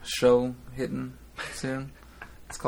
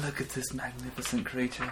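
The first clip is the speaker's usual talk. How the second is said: excited wildlife host whisper; 8 dB quieter than usual. Retold or imitated. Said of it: "I was like".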